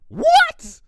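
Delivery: disbelieving squeak; shocked shriek. Acted. Pinkie hears Twilight refused the loan, shocked shriek.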